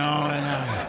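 usual speaking voice

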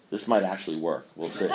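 amused half-aside, softly; quiet laughing aside. Real, not acted. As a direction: mutter it to yourself with a chuckle.

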